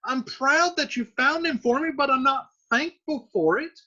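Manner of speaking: daughter paraphrase. Retold or imitated. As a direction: explain it like Juliet would. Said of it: "So she's like".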